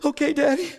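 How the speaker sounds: put-on high small child's voice; meek little girl. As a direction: sound like a chastened toddler. Daughter after being spanked.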